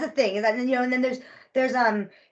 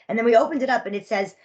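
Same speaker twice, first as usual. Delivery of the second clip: normal talking voice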